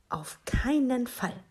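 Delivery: high, slow, drawn-out no; emphatic refusal. A narrator's reading. Mama turns down Minus's plea for a pet, emphatic refusal. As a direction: put the foot down, stretching it out.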